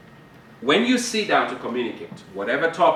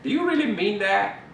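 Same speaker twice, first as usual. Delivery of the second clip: skeptical wife; 11 semitones higher than usual. Retold or imitated. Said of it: "women always say to us men".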